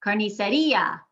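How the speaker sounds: "Carniceria" stressed on RI, car-ni-se-REE-a; strong separate I. Shown as a demonstration. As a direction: split the final I from the A in "Carniceria". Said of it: "has been separated from the A".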